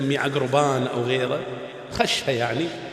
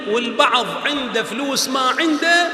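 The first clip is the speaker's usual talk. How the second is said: higher and louder, shouted to the hall; preacher's shout; 7 semitones higher, 3 dB louder than usual. Real, not acted. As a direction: call it out over the congregation. Preacher exclaims to congregation.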